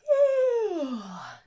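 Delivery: high tired sigh; sleepy sigh. A narrator's reading. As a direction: let out a drowsy sigh.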